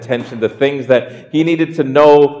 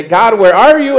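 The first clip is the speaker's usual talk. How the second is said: high, loud desperate cry to God; pleading cry; 9 semitones higher, 7 dB louder than usual. Retold or imitated. A believer crying out to God in doubt, loud and pleading. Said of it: "we question and we wonder".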